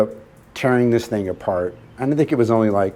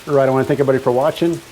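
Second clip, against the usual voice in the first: upbeat outro; 4 semitones higher, faster than usual. Acted. Channel sign-off outro.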